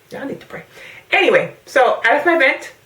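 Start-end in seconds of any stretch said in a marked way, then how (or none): 0.1-0.6 whisper. Real, not acted. Whispered aside to viewers.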